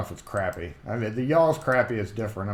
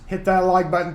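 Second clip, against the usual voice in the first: louder and higher call-out to viewers; hit like reminder; 7 semitones higher, 6 dB louder than usual. Real, not acted. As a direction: punch it out like a host's plug.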